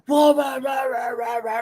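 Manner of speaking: rapid run of barking noises; imitated dog barking. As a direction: bark like an agitated dog. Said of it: "my dog gets up and".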